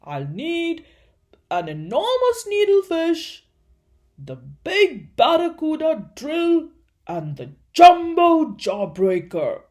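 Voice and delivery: loud, high shouting. A narrator's reading. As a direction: shout the orders. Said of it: "Doctor Dribble shouted".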